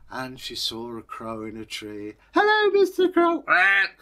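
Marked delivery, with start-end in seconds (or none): 2.3-3.4 put-on chirpy child calling out hello to everything; little girl. Girl greets crow. 3.4-4.0 loud high quack for a crow; comic crow quack; mock bird call. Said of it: "said the crow".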